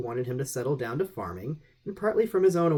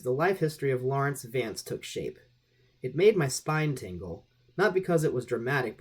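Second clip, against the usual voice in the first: ordinary delivery, nothing marked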